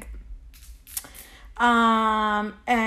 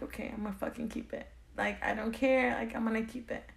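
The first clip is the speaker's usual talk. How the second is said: quiet resolve; 9 dB quieter than usual. Retold or imitated. Decision to keep baby.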